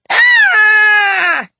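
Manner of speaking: loud, high, long-held scream. A narrator's reading. Children seeing a sooty ghostly figure, frightened scream. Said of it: "the children screamed".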